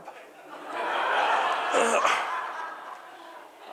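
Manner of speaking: clears his throat, chuckling; a laughing little cough; amused throat clear. Real, not acted. Amused at the mix-up.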